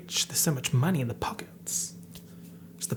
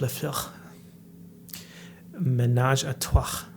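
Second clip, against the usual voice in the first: French accent. Shown as a demonstration. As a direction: roll menage a trois like a hammed-up Frenchman. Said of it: "Get into the French accent".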